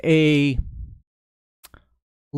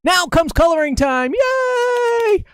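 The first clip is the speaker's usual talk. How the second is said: much higher, cheering yay; excited announcement; 11 semitones higher, slower than usual. Acted. Teacher cheering coloring time for the viewers, excited and high. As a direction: sell it like the fun part starts now.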